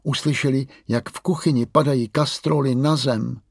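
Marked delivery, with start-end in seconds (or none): none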